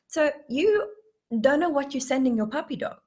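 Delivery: ordinary talk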